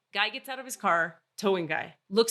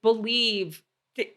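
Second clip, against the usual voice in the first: ordinary talk, nothing marked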